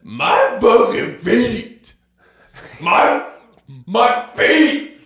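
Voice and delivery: high, loud retold scream of pain; hammed-up, strained holler; imitated scream. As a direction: scream it like dad in agony over his feet. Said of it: "we heard him going ahead and scream".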